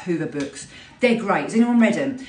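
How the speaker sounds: ordinary talk, nothing marked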